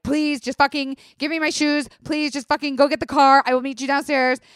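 high, loud, insistent orders; retold strictness. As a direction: act out stern, exasperated commands to a partner. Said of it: "strict and straight with Jared".

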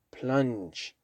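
Plunge ending in a soft zh like orange. Shown as a demonstration. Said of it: "Esa G es como la G de orange".

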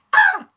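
piercing high shriek; loud high-pitched yelp; imitated scream. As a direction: shriek like a man hit by a javelin. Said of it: "he screams and goes".

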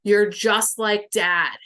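put-on loud, slow accusation from an angry teen; retold teen anger, each word landed hard; pissed-off inner teen. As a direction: imitate a teen laying into a parent, loudly. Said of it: "she is fucking pissed".

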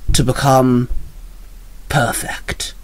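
hushed, deliberate menace after a long pause; villain's hushed reveal. Acted. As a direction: drop into a whisper on the last word. Villain reveals his aim.

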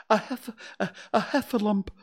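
high, gasping, broken words; Piglet out of breath. A narrator's reading. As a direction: struggle to get the word out. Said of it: "breathing so hard he could hardly speak".

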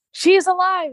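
high-pitched dramatic announcement; mock proclamation. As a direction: imitate a grand, astonished reveal. Acted announcement of actress's arrival.

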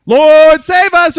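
desperate plea shouted at full volume. Acted. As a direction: cry out to the Lord, high and very loud. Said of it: "I said it louder".

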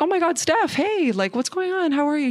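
high-pitched, friendly phone hello; lively re-enacted hello; retold surprised greeting. Greeting cousin-in-law on phone, retold.

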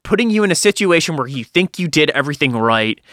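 ordinary talk

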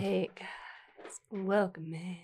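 put-on a light country accent greeting. Greeting viewers in a lightly country accent. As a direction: say hi with a touch of a country drawl. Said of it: "That sounded country".

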